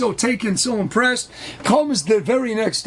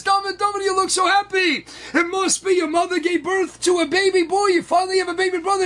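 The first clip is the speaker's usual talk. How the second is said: high-pitched, delighted congratulations; excited exclaiming; 6 semitones higher than usual. Retold or imitated. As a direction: exclaim it high, thrilled for the boy. Rebbe excitedly greets happy boy.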